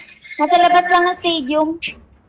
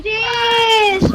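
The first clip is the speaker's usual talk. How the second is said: startled cry; 8 semitones higher than usual. Real, not acted. Sudden surprise in a match.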